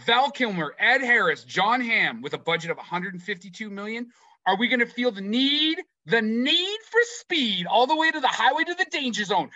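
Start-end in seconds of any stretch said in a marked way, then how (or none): 4.4-9.5 pumped-up hype. Real, not acted. Quoting Top Gun lines hyped.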